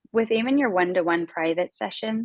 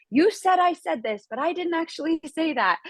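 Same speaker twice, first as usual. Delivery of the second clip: mock angry protest; 9 semitones higher than usual. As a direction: imitate an aggrieved complainer, pitched well up. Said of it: "a line of people angry with me".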